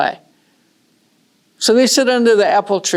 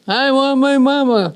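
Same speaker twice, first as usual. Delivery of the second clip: put-on crying little girl; 6 semitones higher than usual. Sister joins, crying.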